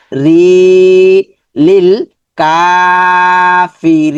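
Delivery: slow লিল কাফিরি, stretching কা and রি; elongated রি. Shown as a demonstration. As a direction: hold রি long and steady. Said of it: "রিটা লম্বা হবে".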